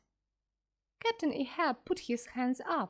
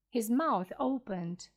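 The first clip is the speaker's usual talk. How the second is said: plain reading voice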